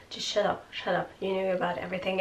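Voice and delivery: soft, fast, mumbled brush-off; imitating an upset man mumbling at a coworker. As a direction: mutter it quickly under your breath. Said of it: "and just starts mumbling".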